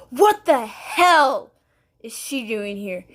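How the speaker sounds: slow, stretched, loud demand; mock outraged stepmom bursting in; angry outburst. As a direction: storm in and demand it. Stepmom bursts in, made-up fight.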